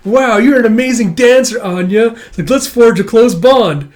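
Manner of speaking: high, loud gushing praise; hammed-up admiring boy voice; imagined admirer. Imagined dance partner voiced.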